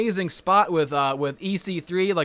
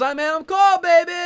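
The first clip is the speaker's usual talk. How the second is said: high, louder Adam Cole catchphrase; cool catchphrase; 11 semitones higher, 5 dB louder than usual. Retold or imitated. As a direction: say your own name like the coolest guy alive. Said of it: "He says his name cool".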